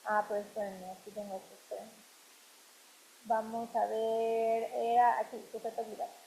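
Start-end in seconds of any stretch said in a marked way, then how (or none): 0.1-1.9 muttering to herself. Real, not acted. Muttering to herself while editing.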